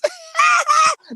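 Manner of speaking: a sudden high shrieking laugh; loud laugh. Real, not acted. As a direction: burst out laughing loudly and high. Laughs at own joke.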